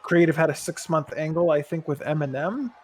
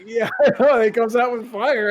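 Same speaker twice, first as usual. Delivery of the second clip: ordinary delivery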